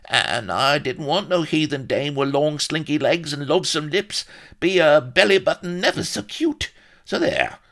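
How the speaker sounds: high, loud babbling rustic; gibbering. A narrator's reading. Solomon faces Blood's scorn, gibbering high. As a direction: gibber excuses, rising in panic. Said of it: "he began to gibber".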